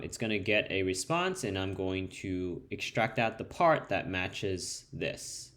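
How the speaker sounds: normal talking voice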